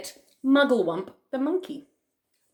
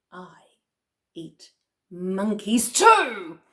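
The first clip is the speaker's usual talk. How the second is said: menacing crocodile; 5 dB louder, much slower than usual. Acted. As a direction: draw it out, then land loud on the end. Crocodile threatens the monkey.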